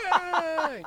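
high mock cheer; crowd cheer. Crowd applauding the twirling speech, high mock cheer. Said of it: "And everybody's like".

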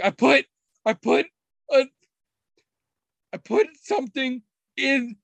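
imitating a toddler barely holding in laughter; giggly toddler. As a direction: blurt it out like a three-year-old bursting with a secret. Toddler giggling excitedly about prank.

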